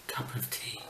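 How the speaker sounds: whispered pun. Acted. Whispering the tea pun to viewers. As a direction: drop it in a whisper.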